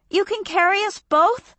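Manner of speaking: can't believe it; startled question. A narrator's reading. Frizz voiced in story.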